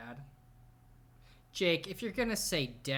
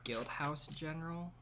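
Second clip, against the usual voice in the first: incredulous whisper; 6 dB quieter than usual. Real, not acted. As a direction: breathe it out in disbelief. Reacting to odd channel name.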